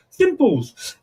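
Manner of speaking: high chirpy meerkat catchphrase. Retold or imitated. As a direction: squeak it like the advert meerkat. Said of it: "was that your Meerkat impression then?".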